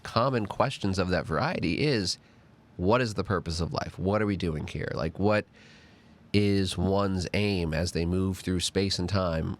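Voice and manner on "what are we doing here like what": ordinary talk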